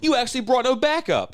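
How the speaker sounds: mock him in a high squeaky voice; high mocking taunt. Mocking a hero who brought no backup, in a high squeaky taunt.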